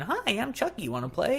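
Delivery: a talking doll's sing-song greeting; Chucky doll voice. Retold or imitated. [name] recalls the doll chirping at her, high doll voice. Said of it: "talking and going".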